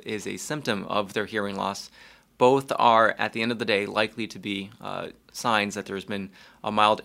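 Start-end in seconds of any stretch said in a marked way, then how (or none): none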